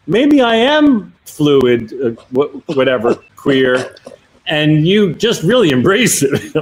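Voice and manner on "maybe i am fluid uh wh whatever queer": dreamy musing. Retold or imitated. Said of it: "you came out of that meeting like".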